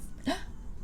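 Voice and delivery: quiet breathy gasp of delight; soft delighted gasp. Pretend. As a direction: gasp softly as if thrilled. Delighted over the card holder.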